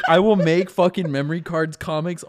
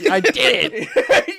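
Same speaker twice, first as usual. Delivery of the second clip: slow, higher, laughing through it; proud laughing; 7 semitones higher, much slower than usual. Acted. Xander proud after fist pump.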